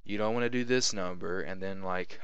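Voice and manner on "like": ordinary talk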